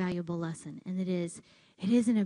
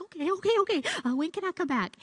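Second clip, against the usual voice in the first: startled okay; 10 semitones higher, 4 dB louder, faster than usual. Retold or imitated. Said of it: "I was like".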